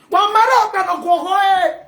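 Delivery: high, loud mock crying wail; half-joking crying outburst. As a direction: wail it like a hammed-up lament. Wailing lament in a rant.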